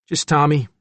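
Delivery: put-on surly teenager brushing someone off; a touch of hostile defiance; sullen. A narrator's reading. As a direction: keep her at arm's length, sullenly. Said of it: "Tommy remained sullen".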